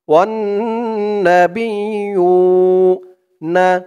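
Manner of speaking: ওয়ান্নাবিয়ুনা with the doubled ন্না held in the nose; slow, nasalised ন্না in ওয়ান্নাবিয়ুনা; nasal ghunna. Shown as a demonstration. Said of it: "ওয়াজিব গুন্না".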